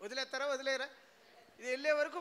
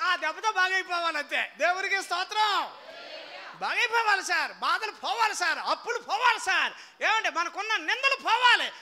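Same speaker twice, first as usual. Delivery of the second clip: loud, high, driving preaching; preaching at full force; 5 semitones higher, 8 dB louder than usual. Acted. Preacher drives the closing declarations to the crowd, loud and high. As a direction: hammer each line home. Climactic sermon close.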